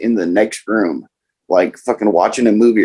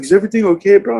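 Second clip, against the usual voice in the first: buddies checking in; 11 semitones higher, much faster than usual. Retold or imitated. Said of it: "And they're like".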